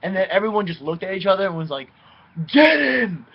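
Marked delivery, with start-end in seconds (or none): 2.5-3.3 retold shout. Kids rally against the owner.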